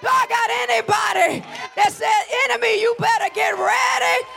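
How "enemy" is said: ordinary talk, nothing marked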